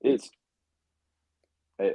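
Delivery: ordinary talk, nothing marked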